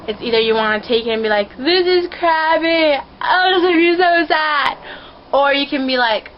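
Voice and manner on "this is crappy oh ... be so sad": high, loud mock wail; hammed-up self-pity, pitched up; mock whining complaint. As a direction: whine it in an exaggerated, drawn-out way. Acting out the negative outlook.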